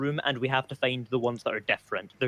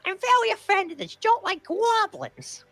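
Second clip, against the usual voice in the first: squeaky goblin voice, much higher. Acted. Repeats line in goblin voice.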